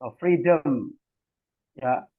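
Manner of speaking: normal talking voice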